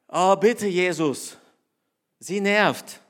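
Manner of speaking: loud, whiny plea to make her stop; exasperated plea. Retold or imitated. Disciples pleading loudly with Jesus to silence her, exasperated. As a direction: beg loudly like annoyed disciples.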